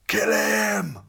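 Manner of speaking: a high, loud bandit roar. A narrator's reading. Robber leader orders his men to attack, roaring. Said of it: "roared the leader of the robbers".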